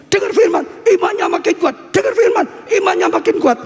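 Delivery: rhythmic rallying refrain, building in fervor; rousing preaching. Acted.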